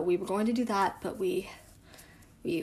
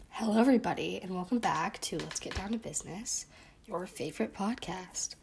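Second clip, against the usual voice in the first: podcast intro; 2 semitones lower, 5 dB quieter than usual. Acted. Another intro retake.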